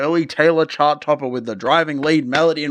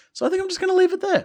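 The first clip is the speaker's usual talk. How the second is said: silly high sign-off; much faster than usual. Pretend. Playful sign-off.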